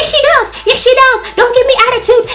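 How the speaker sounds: very high, hammed-up screech; squealing put-on outrage; mock screechy retort. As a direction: imitate her shrieking back in repeated bursts. Mimicking roommate shrieking back.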